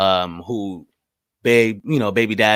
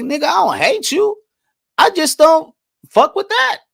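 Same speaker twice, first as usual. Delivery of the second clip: pitched way up, louder, imitating a friend brushing it off; mock blunt reply; 6 dB louder than usual. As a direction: act out the friend's blunt dismissal in a high voice.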